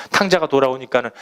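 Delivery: usual speaking voice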